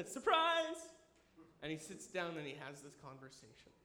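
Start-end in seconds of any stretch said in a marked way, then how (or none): 0.1-0.8 surprise. Acted. God shows up to Jonah.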